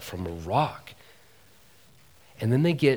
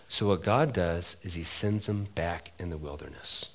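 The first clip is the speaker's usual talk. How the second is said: normal talking voice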